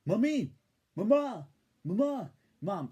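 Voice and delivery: high, loud, slow whiny cartoon baby; Stewie. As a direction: nag mom like a spoiled cartoon baby. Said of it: "Stewie goes".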